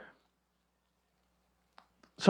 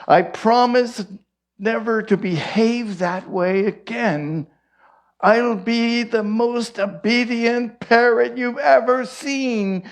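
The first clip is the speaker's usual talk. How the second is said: contrite parrot; 6 semitones higher than usual. Acted. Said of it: "and says".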